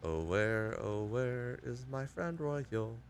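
creepy taunting killer. Pretend. Hunter taunting the survivor.

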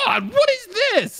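high-pitched astonishment; gasping out in amazement; amazed exclamation. Acted. Rainy seeing Dash's huge train, amazed.

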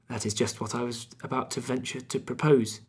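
ordinary delivery, nothing marked

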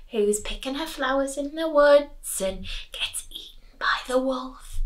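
whispered aside; soft whisper. Real, not acted. Teacher recalling the traditional tale to pupils, whispered. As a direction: hush it for effect. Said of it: "teacher whispers in question".

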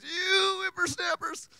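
put-on high, slow creaky old man; old grandpa voice. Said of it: "he asks Old Man Jenkins".

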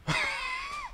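high squeaky laugh. Real, not acted. Laughing in disbelief.

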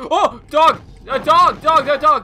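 panicked yelling at a chasing dog; being chased. Real, not acted. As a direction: scream in panic while fleeing.

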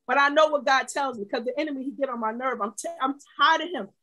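ordinary talk

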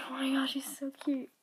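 soft awed whisper. Real, not acted. Gushing over the toy in an awed whisper. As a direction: breathe it out, barely voiced.

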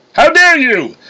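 high, loud indignant cry; outraged. A narrator's reading. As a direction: explode at a brother's insult. Thor outraged by brother's insult.

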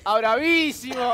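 loud, high, drawn-out Argentine exclamation; Argentine accent. Acted. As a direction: stretch it out like a hammed-up Argentine. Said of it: "¡Argentino!". (acento argentino exagerado, lento y fuerte)

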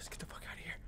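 urgent whisper; breathy whispered escape; whispered panic. Real, not acted.

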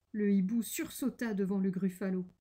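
ordinary delivery, nothing marked

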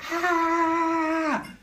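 high imitated shriek of his daughter; imitated scream. Dad retelling his daughter's scream on the ride, imitated high shriek. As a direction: mimic the girl's sudden shriek, high. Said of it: "you hear this scream".

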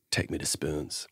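low, soft, exhausted plea; 1 weary sigh. Retold or imitated. Tired singer alone in front of the mirror, low and soft. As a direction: let it fall out of you, spent. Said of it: "looks himself in the mirror and he goes".

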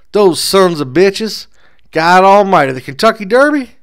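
high, loud, slow furious cry; outraged outburst. A narrator's reading. Bar patron cursing the troublemakers, high loud outrage. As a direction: bellow it, scandalised by the news. Outraged man hears Derby threat.

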